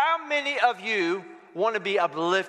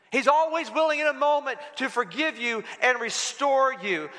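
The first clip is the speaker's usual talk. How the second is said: raised preaching; 6 semitones higher than usual. Acted. Preaching repeat.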